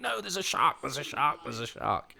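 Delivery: mock-panicked cry, pitched high then dropping; re-enacted losing it over a danger; retold panic. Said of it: "absolutely losing it and going".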